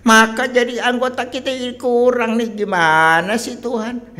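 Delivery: loud, high-pitched mock protest; hammed-up blaming wail; complaining to God. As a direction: whine it at full volume, dragging the end. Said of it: "Kita boleh mengeluh sama Tuhan".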